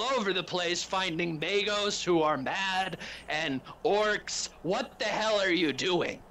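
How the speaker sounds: a commander tearing into his team at full volume; furious Inquisitor. Acted. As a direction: chew out your subordinates. Inquisitor berates his acolytes.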